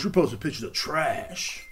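slow, mock accusing voice; mocking critics. Critics attacking his Instagram posts, in a slow mock scold. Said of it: "people, like, talk shit about me".

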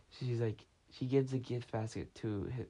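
ordinary delivery

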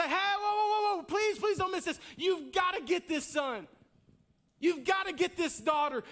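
loud, high pleading shout; frantic father stopping a child; urgent warning. Retold or imitated. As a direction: grab them and plead, don't let them miss it. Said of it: "grab you by the face and say".

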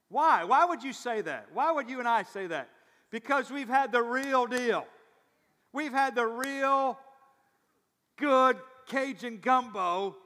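loud, high, drawn-out emphasis; fired-up preaching. Real, not acted. Pastor rallying South Louisianans over the real thing, fired up. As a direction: belt it out to the congregation, stretching key words.

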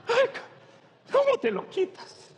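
high, pained whimper; mock whine. Preacher imitating someone suffering a burning itch, high and pained. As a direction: squeal it like it burns.

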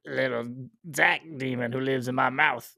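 slow, strange little creature voice; put-on demon voice. Co-host joking about a third host, in a put-on demon voice. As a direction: drag it out like a possessed voice.

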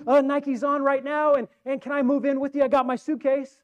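a fan gushing nonstop at his idol; starstruck superfan. Acted. Fan gushing to Jordan himself.

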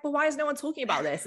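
high-pitched, baffled question; puzzled protest. Retold or imitated. Retelling own puzzled protest to mentor.

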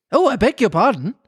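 a touch of alarm in Alice's voice; hasty apology. A narrator's reading. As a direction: blurt a polite apology. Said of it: "cried Alice hastily".

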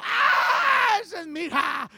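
high, loud proud exclamation; excited new parent. Retold or imitated. Father and mother hear their newborn, overjoyed shout. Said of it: "ya oigo al papá, la mamá".

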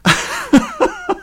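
high-pitched chuckle; real laugh at one's own story. Real, not acted. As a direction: laugh in a high, quick run. Laughs after telling of the cheering.

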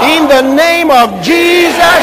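slow, loud proclamation; booming declaration of faith; full-force preaching. Real, not acted. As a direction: drive each phrase out slowly and forcefully for the crowd to echo.

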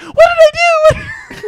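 high, drawn-out squealing protest; Brendan. Acted. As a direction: wail it loudly in a mock squeaky voice. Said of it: "his voice is high like this".

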